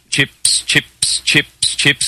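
"chips" drilled over and over with a short I; whispery, careful rehearsal of "chips"; practising pronunciation. Acted. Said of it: "he works on his accent".

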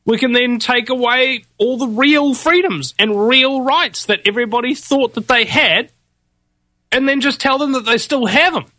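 high, mocking schemer's voice; high-voiced parody of those in power. Voices the New World Order's scheming.

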